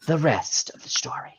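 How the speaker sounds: breathy whispered sign-off; hushed parody of the radio catchphrase; mock Paul Harvey whisper.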